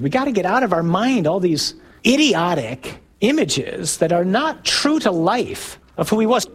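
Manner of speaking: ordinary talk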